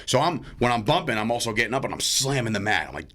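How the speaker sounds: normal talking voice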